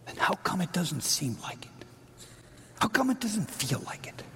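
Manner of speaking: unvoiced whisper of doubt. Preacher voicing the doubter's question, whispered. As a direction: breathe the doubt out quietly.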